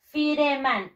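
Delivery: "Fireman" sounded as fi-re-man, Spanish letter values; spelling pronunciation. Shown as a demonstration. Teacher repeating the spelling to pupils, "Fireman" as fi-re-man. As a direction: read "Fireman" just as it is spelt, fi-re-man. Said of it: "Así se escribe".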